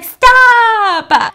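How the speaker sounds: loud, high frightened cry; panicked scream. Retold or imitated. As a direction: scream for him to stop shaking it. Scared girlfriend on shaking bench.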